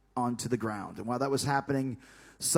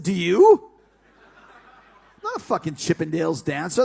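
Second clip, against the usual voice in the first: loud retort; 3 semitones higher, 7 dB louder than usual. Retold or imitated. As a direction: throw the question right back, loud. Retold comeback to Michael Hayes.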